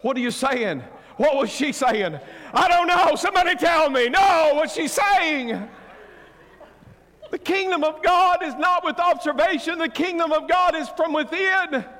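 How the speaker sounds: very high, shouted sermon delivery; full-force preaching. Real, not acted. Preacher proclaiming the kingdom within to congregation, full-force shouted preaching.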